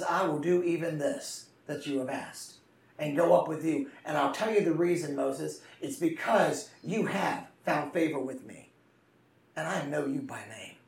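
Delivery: quiet, intimate voice of God answering Moses; tender God voice. Acted. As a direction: lean in and speak as a loving God.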